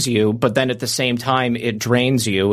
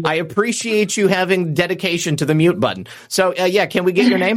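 bright, higher call-in host read; radio host announcer; 7 semitones higher than usual. Acted. Radio host introducing the last callers, announcer voice. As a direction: pitch it up and announce the next caller.

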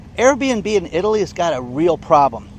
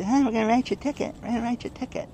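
high, fast, softer mocking voice; mimicked ticket writer; 7 semitones higher, 5 dB quieter, much faster than usual. Street sweeper coming through writing everybody tickets, high mocking mimicry.

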